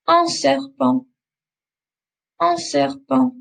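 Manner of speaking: "serpent" with nasal ending and no T sound; teacher models "Un serpent" with silent T; silent final T. Shown as a demonstration. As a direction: end "serpent" on the nasal vowel, no T. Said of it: "I'm not pronouncing the T at the end".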